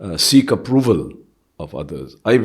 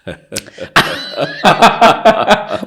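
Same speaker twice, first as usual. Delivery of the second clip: high, loud burst of giggling; giggle; 8 semitones higher, 6 dB louder than usual. Real, not acted. Guest reacting to unspoken stories question, giggling. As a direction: giggle helplessly before answering. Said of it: "What is this giggle about?".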